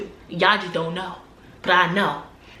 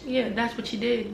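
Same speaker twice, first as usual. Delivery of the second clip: ordinary delivery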